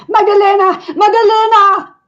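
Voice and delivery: high, loud desperate calling; shouting a name. Acted. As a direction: call out her name across the crowd. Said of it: "she shouts".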